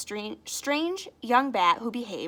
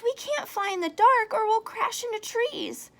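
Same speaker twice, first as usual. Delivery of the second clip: high little bat voice; worried young bat; 12 semitones higher, 4 dB louder than usual. Acted. Stellaluna worries about the dark, high voice. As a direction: fret like a small bat.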